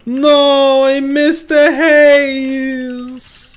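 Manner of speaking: high, loud, slow cartoon wail; jolly italian pizza chef. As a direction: ham up a cartoon chef's dismay. Missed shot in game, cries out.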